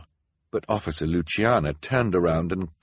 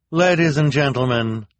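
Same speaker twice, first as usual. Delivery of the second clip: raised, higher announcing voice; Officer Luciana; 7 semitones higher, 6 dB louder than usual. A narrator's reading. Luciana announcing the prisoner to the town, raised voice. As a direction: present to the crowd. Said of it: "Officer Luciana said".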